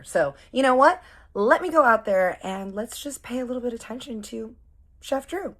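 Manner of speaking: high, loud spiteful flirt; spiteful sass. Retold or imitated. Scheming to flirt with the chef out of spite, sassy. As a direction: sweetly plot revenge out loud. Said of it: "feeling angry and spiteful and spicy".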